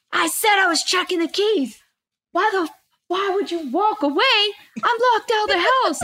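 high-pitched mock rant of an angry husband; imitated husband yelling. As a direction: imitate his indignant complaining. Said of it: "And he's yelling at me".

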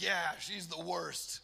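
loud, drawn-out comic complaint with a laugh; hammed-up grievance; mock venting. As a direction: vent it slowly and emphatically, half laughing. Venting about Kate, comic retelling.

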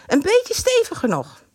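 high sweet asking; girlish voice. A narrator's reading. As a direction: ask him politely. Girl voice in the story.

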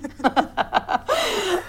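high burst of real laughter; real amused laugh; big laugh. Real, not acted. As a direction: crack up at the joke. Laughing at host's joke.

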